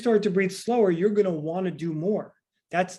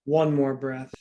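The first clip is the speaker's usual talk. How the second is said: guided breathing count; 4 semitones lower, much slower than usual. Acted. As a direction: count the breaths slowly to calm them. Coach counts breathing for class.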